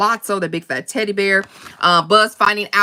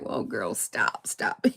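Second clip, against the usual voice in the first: low, soft smooth-talking player; mock Stinky Pete pickup line; old-school player; 11 semitones lower, 12 dB quieter, slower than usual. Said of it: "He be like".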